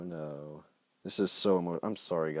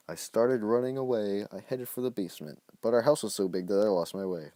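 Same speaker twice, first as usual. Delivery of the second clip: louder, higher dramatic narration; narrating his kid-written story with drama; 4 semitones higher, 7 dB louder than usual. A narrator's reading. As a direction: read the escape like an action tale. Reading his old story aloud.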